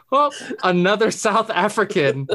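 amused mock quote, giggling; laughing quote. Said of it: "what a sentence to say".

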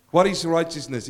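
ordinary talk, nothing marked

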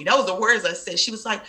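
ordinary talk

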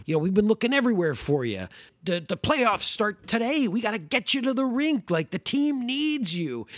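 eager, urgent and fast; excited cop. Retold or imitated. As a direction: rush it like an excited fan. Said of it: "And he says".

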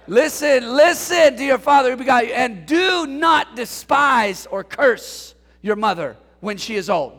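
fired-up sermon delivery; full preaching. Acted. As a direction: belt it out from the pulpit. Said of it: "I'm feeling it".

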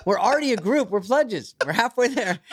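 high, laughing call to revolt; a giggly, high rallying cry; laughing mock rally. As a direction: rouse the pledges half-laughing.

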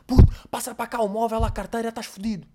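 high put-on street mugger threat. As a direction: voice a thug demanding the wallet. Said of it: "Isto não foi sotaque de nada".

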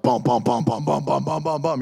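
rapid run of thudding bums; imitated bumping noise. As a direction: bounce out the speed bumps vocally. Imitating a bus over bumps.

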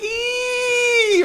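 very high, sustained mock squeal; imitated high squeal. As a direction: squeal like a flung toy rabbit. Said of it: "it's just like".

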